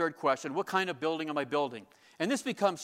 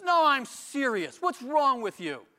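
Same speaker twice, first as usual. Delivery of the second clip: earnest rebuke; 8 semitones higher, 5 dB louder, slower than usual. Retold or imitated. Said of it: "He says".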